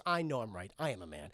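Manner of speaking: low, soft, smug suitor; manly man. Retold or imitated. Suitor agrees with the flattering mother, manly. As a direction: drop deep and quiet, self-assured.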